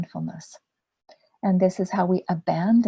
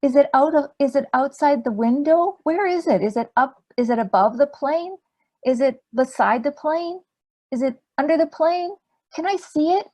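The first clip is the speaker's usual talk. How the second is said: put-on high child voice, rising questions; curious little boy; 7 semitones higher, 5 dB louder than usual. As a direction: fire off questions like an eager four-year-old. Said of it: "but he said".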